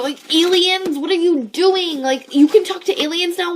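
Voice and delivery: high, loud disbelief; incredulous rant. Real, not acted. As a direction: demand answers from the absurd plot.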